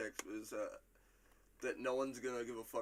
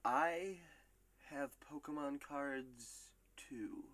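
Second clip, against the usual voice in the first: whispered quote; 4 dB quieter, much slower than usual. Acted. Quoting a funny line.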